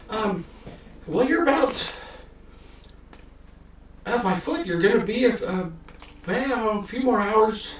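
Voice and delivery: put-on high, loud squeaky old man giving directions; old halfling telling the party how far the city is, squeaky voice; chatty old halfling. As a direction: pitch way up, rambling kindly.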